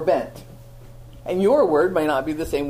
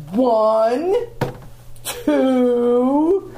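mock mother counting, higher and louder; a mother counting at her kids, imitated higher and louder; counting mom; 8 semitones higher, 4 dB louder than usual. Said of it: "and she goes".

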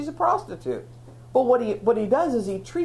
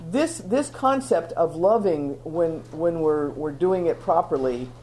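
usual speaking voice

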